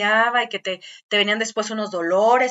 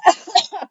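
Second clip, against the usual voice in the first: laughing; 9 semitones higher, 5 dB louder than usual. Real, not acted. Laughs at flatulence joke.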